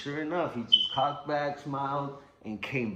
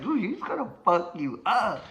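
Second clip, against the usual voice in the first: high mocking warning; 10 semitones higher, 5 dB louder than usual. Everyone warning him.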